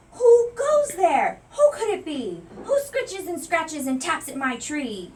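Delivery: big, loud, slow holler. A narrator's reading. Scared mouse hollering into the dark at his tree. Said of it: "hollered in his biggest voice yet".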